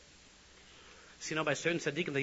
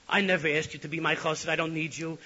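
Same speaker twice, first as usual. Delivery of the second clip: angry retort; 4 semitones higher, 8 dB louder than usual. Retold or imitated. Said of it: "began to shake and he turned red".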